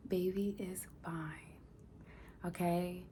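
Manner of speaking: soft whisper, slow and tender; whispered reassurance. Real, not acted. Vlogger comforting viewers, a slow whisper. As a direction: breathe it out to comfort them.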